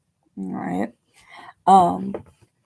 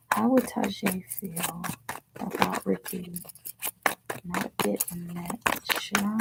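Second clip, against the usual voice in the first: a soft, unvoiced whisper of the question; 6 dB quieter than usual. Real, not acted. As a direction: whisper it under your breath while shuffling. Repeating the question while shuffling.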